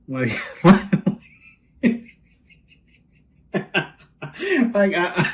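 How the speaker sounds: breathy high laugh into "like"; real laughter breaking the sentence. Real, not acted. Laughing at the card.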